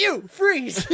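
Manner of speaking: loud, high shout to halt someone; sudden high yell; shouted challenge. Acted. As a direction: bark it out like a startled patrolman. Acting out Jason challenging Batman.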